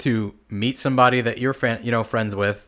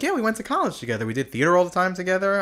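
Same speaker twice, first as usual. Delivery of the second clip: high chatty voice; 8 semitones higher, faster than usual. Retold or imitated. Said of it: "I was like".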